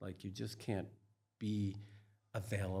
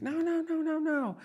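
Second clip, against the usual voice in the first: much higher, louder desperate cries; panicked no; 7 dB louder than usual. Retold or imitated. Curled up on the bed in terror, desperate crying no. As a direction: cry out no over and over in terror. Writhing in fetal position.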